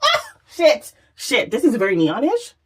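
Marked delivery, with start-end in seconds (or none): 0.0-0.9 high, sudden yelp of surprise; startled high-pitched cry; surprised squeal. Real, not acted. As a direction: gasp it out, way up high. Shocked by the glow.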